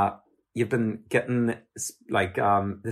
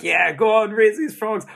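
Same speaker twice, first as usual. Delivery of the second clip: higher-pitched, imitated cheer of encouragement; 9 semitones higher, 3 dB louder than usual. Interviewer voices fans gunning for the frog raiser, cheering on. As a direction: egg them on like a keen supporter. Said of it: "gunning for you, kind of being like".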